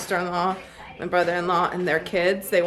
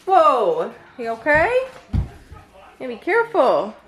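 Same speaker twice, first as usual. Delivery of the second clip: sudden louder, higher exclamation; startled loud call; 5 semitones higher, 7 dB louder than usual. Real, not acted. Baby fussing, she reacts suddenly.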